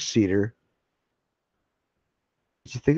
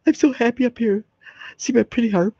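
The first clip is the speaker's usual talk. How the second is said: high, sugary sing-song angel in heaven; mock angel voice; 13 semitones higher than usual. Mocking heaven's endless bliss in a high, sweet angel voice. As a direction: ham up a blissed-out harp-playing soul.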